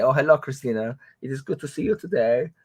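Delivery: a hint of a stiff German relative; mock German accent. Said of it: "He doesn't say".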